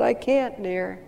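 soft, tender mother's reply; gentle reassuring mother. Acted. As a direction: hush him gently to sleep. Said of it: "gave him a reassuring hug".

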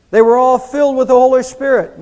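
loud proclaiming. Real, not acted. Preacher proclaiming.

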